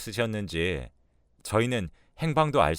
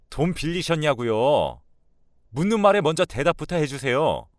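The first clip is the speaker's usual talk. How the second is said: raised, impatient demand; high-pitched, exasperated questioning; insistent son; 7 semitones higher, 5 dB louder than usual. Acted. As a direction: press her hard for an answer. Son presses mother for answer.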